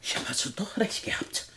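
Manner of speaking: very soft, almost voiceless murmur; breath-soft realisation; hushed shock. Acted. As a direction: barely voice it, stunned by the intruder. Shocked at intruder's appearance.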